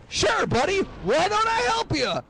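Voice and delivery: very high, eager TV host voice; Regis Philbin. Retold or imitated. TV host agrees to bail out caller, high eager voice. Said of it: "And he goes".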